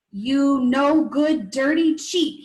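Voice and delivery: loud, slow, angry shout at a cheat. A narrator's reading. Passerby shouting at the swindler, loud and angry. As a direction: bellow the accusation with outrage. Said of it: "he shouted".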